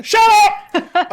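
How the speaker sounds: very high, loud shriek of "shut up"; a frazzled woman cutting the kid off with a yell; sudden shrill outburst; snapping shout. Acted. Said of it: "you hear somebody going".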